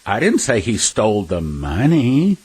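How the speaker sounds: "money" stretched and lifted, as if something else was stolen; stress on MONEY. Shown as a demonstration. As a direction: save the weight for "money" at the end. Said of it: "with our voice inflection".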